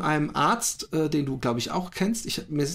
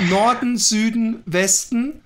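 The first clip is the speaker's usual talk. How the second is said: slow, raised list of names; riddle recital; 4 semitones higher, 4 dB louder, slower than usual. Shown as a demonstration. Host resuming live riddle for co-host, slow deliberate listing. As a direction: space out each name deliberately. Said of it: "Machen wir live mit dir den Intelligenztest".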